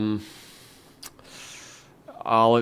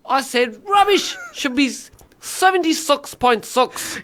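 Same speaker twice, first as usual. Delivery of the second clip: higher, indignant Kiwi; mock New Zealand accent; 6 semitones higher than usual. Co-host disputing a score, mock Kiwi accent. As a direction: overdo the Kiwi vowels in protest. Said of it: "just New Zealand accent".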